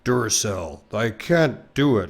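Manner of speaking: failed deep-voice try; slow dude voice attempt. Shown as a demonstration. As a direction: stretch it out reaching for deep. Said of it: "can't have a deep enough voice".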